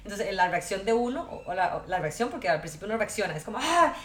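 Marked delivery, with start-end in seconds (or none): 3.6-3.9 drawn-out, louder gasp of road annoyance; mock outburst at a bad driver, pitched up and slow; angry reaction. Driver reacting when someone cuts across the car, irritated yell. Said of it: "entonces uno tal vez grita".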